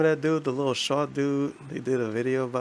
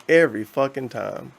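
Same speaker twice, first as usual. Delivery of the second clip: usual speaking voice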